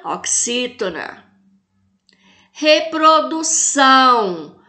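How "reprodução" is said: "Reprodução" syllable by syllable, final "ção" long and strong; slow "Reprodução" right down, pushing on "ção"; stressed last syllable. Shown as a demonstration. Teacher quizzing class on tonic syllable, "ção" stressed in "Reprodução". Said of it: "Qual que é a sílaba tônica?".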